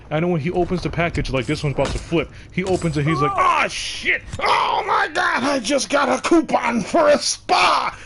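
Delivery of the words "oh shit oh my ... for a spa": high, loud, slow mock crying shout of joy; freaking out. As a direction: ham up the roommate sobbing with joy over a coupon. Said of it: "he's freaking out".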